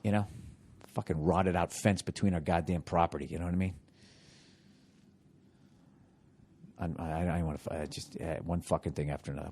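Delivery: dropped down, keeping it quiet; hushed voice. Real, not acted. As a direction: talk low so the neighbor can't hear. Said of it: "I love how I'm whispering right now".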